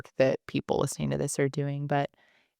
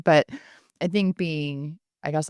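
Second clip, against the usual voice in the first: ordinary talk, nothing marked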